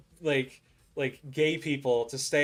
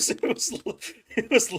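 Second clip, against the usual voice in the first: breaking up mid-phrase; high voice caught in a laugh; 10 semitones higher, 4 dB louder than usual. Real, not acted. Laughing at the tiny payout.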